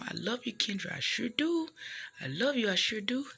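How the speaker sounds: high, affectionate sing-out; high-voiced, loving phone farewell; loving friend's sign-off. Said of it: "like she always, when we get off the phone".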